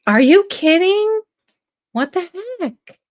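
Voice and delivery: loud, high and slow disbelief; surprised. Real, not acted. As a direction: gasp it out in disbelief. Reacting to chat news.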